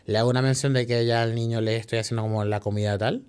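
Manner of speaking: normal talking voice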